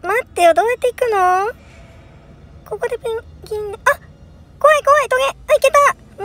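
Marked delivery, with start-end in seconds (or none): 4.6-6.2 high startled cries; hit hard crying out. Real, not acted. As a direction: yelp past the danger. Dodging spikes in game.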